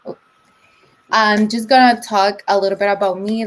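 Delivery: normal talking voice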